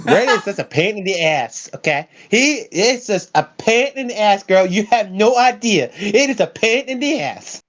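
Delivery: put-on high sassy girl voice. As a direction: sass it up, girl.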